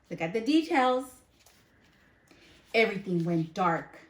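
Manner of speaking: ordinary delivery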